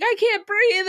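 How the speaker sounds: high, loud put-on wheeze; hammed-up struggle for air; mock gasping complaint. Reviewer mimics the pilot choking at altitude, high and hammed-up. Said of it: "And he's like".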